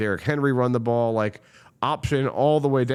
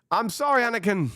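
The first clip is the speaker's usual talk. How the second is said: Obi-Wan lament. Acted. Fan sending Lamar off with a hammed-up, high, grieving Star Wars farewell. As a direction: ham up a betrayed mentor's farewell.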